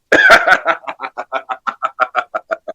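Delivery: evil chuckle, low and drawn out; villain laugh. Acted. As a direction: cackle like a cartoon villain. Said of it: "that was a villain laugh".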